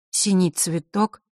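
usual speaking voice

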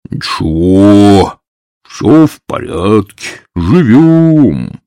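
slow, lightly voiced bass; drawn-out easy rumble; deep bass voice. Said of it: "басил".

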